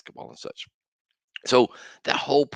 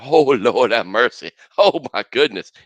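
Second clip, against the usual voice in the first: loud, slowed, laughing rapture over a bite; imitating delight; 3 semitones higher, 10 dB louder than usual. Host retelling the TikTok taster's joy, loud and laughing. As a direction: mimic someone blissed out by the taste. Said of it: "He was like".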